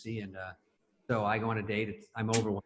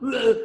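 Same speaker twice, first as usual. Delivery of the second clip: loud, high "ugh" of dismay; short burst of disgusted shock; horrified groan; 11 semitones higher, 7 dB louder than usual. Retold or imitated. Guest acting out his film character's discovery, loud groan. Acts out reaction to mistaken texting.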